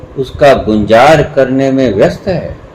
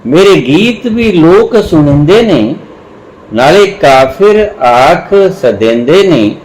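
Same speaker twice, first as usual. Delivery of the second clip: drawn-out recital with lingering line endings; poetry recitation; 2 semitones higher than usual. Acted. Recites Punjabi poem at length.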